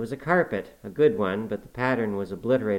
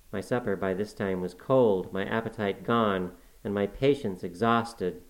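usual speaking voice

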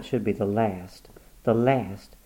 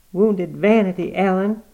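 a higher, louder, scolding inner voice; sharp voice; 8 semitones higher, 5 dB louder than usual. A narrator's reading. Inner voice scolding Granny for her vanity, sharp. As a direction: snap the words out like a stern conscience. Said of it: "said a sharp voice".

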